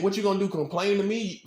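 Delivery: usual speaking voice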